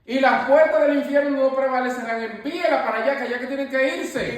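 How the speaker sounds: high, loud preaching, hammering each line; fiery preaching. Acted. Rebuking doubtful talk before the prayer group, loud fiery preaching. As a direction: preach it, driving every phrase home.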